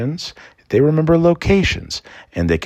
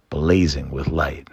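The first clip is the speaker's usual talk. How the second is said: ordinary delivery, nothing marked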